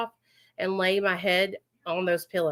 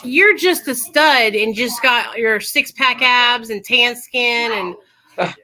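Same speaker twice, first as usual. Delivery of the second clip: playful gushing; 3 semitones higher, 8 dB louder, slower than usual. Acted. Gushing teasing over the speaker.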